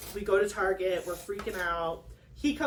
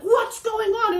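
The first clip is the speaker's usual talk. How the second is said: much higher, louder alarmed cry; startled stepdad; 11 semitones higher, 6 dB louder, slower than usual. Retold or imitated. Naked stepdad bursting into the living room, alarmed shout. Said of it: "he's like".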